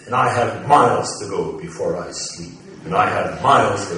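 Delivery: loud, ringing and emphatic; proud defiant declamation; very strong voice. Retold or imitated. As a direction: belt it out like a vow. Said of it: "he made his voice very strong".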